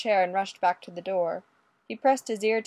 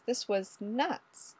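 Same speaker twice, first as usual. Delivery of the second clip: "This was nuts" drawn out slowly and softer; slow, dazed repeat; 6 dB quieter, much slower than usual. A narrator's reading. Ray's repeated dazed thought.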